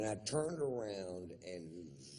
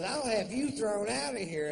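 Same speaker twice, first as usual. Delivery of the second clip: much higher, louder woman's threat; shrill mock woman; 7 dB louder than usual. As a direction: play her as an outraged shrieking lady.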